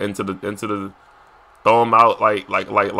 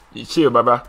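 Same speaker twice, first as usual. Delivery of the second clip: normal talking voice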